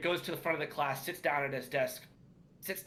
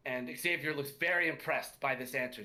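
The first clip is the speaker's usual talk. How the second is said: ordinary talk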